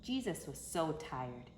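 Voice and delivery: plain reading voice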